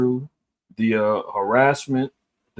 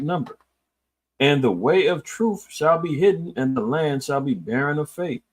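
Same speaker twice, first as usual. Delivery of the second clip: raised, solemn reading of verses; scripture reading; 4 semitones higher than usual. Acted. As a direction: read the verses like holy writ. Reads scripture aloud.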